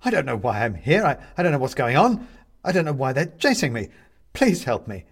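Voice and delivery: loud, desperate plea; at his wits' end. A narrator's reading. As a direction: beg for help, overwhelmed. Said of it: "coming to the end of his ability to deal with it".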